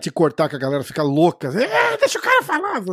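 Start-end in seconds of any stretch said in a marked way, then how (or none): 1.6-2.8 much higher mocking voice. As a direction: squeak it like a mocking fan. Mocking the audience reaction.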